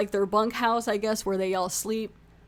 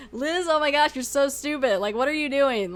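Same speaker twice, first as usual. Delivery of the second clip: higher-pitched, incredulous telling-off; exasperated scolding; 5 semitones higher than usual. Retold or imitated. Viewer scolding Liz for being stupid, exasperated. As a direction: scold her in retold exasperation. Retold outburst at character on screen.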